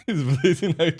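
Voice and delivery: gleeful laughing words. Real, not acted. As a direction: laugh through it. Laughing at downed enemy.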